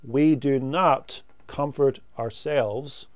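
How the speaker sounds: ordinary delivery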